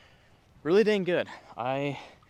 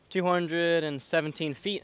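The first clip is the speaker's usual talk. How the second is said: ordinary talk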